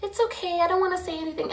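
small, high, soft voice; timid friend. Retold or imitated. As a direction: mimic a meek friend backing down.

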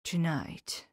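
soft, hushed, gentle whisper; tender. Acted. Professor gently whispering to his grieving friend. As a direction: break the news gently. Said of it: "the tenderness of his face".

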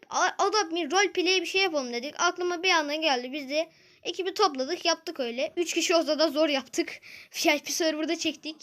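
ordinary delivery

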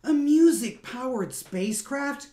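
high, loud astonished question; can't believe the idea; amazed. Acted. Breather hears about the ship, high astonished disbelief.

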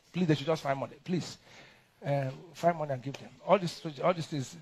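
ordinary delivery

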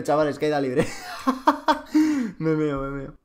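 laughing. Real, not acted. Laughing at enemy fall.

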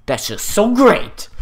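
loud, high, mock-furious outburst; pissed. Player acting out Emma's pissed reply, loud and exaggerated. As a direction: drag it out in hammed-up annoyance. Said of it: "that sounds kinda pissed".

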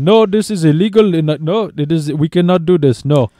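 mock protest in an Indian accent, high-pitched; retold screaming. Deli man taking it for a prank call, mock Indian-accented outcry. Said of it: "he just started screaming at me".

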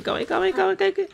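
high, frantic panicked pleading; panicked calma aí. Real, not acted. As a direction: squeal it out in a panic. Panicking as character dies.

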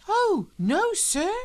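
put-on very high, loud boy's denial; horrified. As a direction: gasp out a shocked denial. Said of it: "said Paul, horrified".

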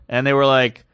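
ordinary delivery

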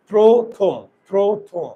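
প্রথম in two stretched beats, "pro-thom"; syllable demonstration. Shown as a demonstration. Teacher counting two syllables, প্রথম loud and split as "pro-thom". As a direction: sound out প্রথম syllable by syllable with closed "o". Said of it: "প্রথম শব্দে কয়টা syllable?".

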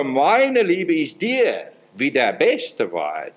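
very slow, weighty verse; slow, solemn delivery of the verse; slow devout quote. Acted. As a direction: spell it out word by word, slowly. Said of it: "Als gereifte Jungfrau".

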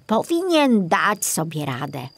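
ordinary delivery, nothing marked

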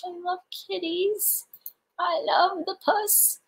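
high soft cooing; baby talk. Pretend. Gushing about a viewer's kitten, high soft baby talk.

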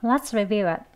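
Let's review it run together quickly, as las rev a; fast casual. Shown as a demonstration. Teacher models rapid conversational Let's review it. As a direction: clip it like rushed conversation. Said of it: "빠르게 하는 대화 발음".

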